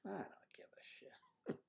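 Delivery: slow, voiceless whisper of the buddy; whispered quote. Retold or imitated. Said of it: "He says".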